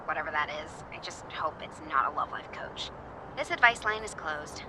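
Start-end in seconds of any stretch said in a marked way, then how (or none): 0.1-2.9 snide whispered aside under the breath. Acted. Radio host whispering a catty aside after hanging up. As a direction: whisper it as if off-mic, a little catty.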